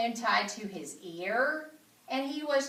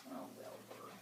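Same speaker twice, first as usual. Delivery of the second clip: breathy whisper of sympathy; whispered pity; 19 dB quieter than usual. Real, not acted. The reader to listeners, a whispered aside of pity. Narrator pities Wilbur.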